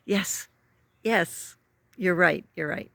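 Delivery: a touch of hammed-up agreement; mock eager agreement. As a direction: concede brightly, almost sing-song. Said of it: "this time I was like".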